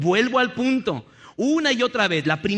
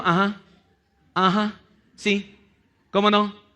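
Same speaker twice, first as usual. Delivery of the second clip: absent, slow uh-huhs with gaps between; distracted husband. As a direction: answer without listening, as if glued to the screen. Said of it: "No está oyendo, te está ignorando totalmente".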